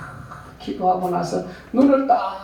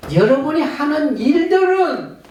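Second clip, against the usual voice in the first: high, loud preaching voice, slowing on the gift; full-force preaching; 9 semitones higher, 5 dB louder than usual. Acted. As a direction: proclaim it to the congregation. Building sermon list. (높고 힘찬 설교)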